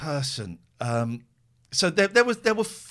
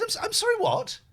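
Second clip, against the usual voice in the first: incredulous what; 14 semitones higher than usual. Retold or imitated. Retelling disbelief at booking.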